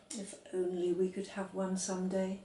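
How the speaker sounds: a quiet longing aside; wistful to herself. A narrator's reading. Girl wishing to herself for a dog, softly wistful. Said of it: "she said to herself".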